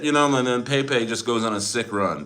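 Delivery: ordinary talk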